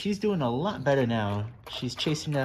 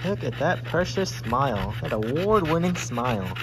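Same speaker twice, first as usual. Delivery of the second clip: high, louder admiring coo; gushing dog talk; 5 semitones higher, 3 dB louder than usual. Real, not acted. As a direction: adore the dog's smile.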